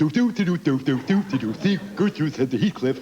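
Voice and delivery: lightly imitating Heathcliff the seagull; imitating a seagull while writing jokes. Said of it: "and I'm going".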